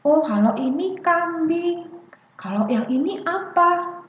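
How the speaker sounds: put-on high-pitched child-directed naming; name it, then gently ask the child; parent reading along. Shown as a demonstration. Parent reading a picture book with a child, lilting. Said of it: "Kita lagi baca buku nih misalnya".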